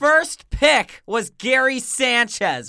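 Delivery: mock outrage. Mock outrage at Yankee jab.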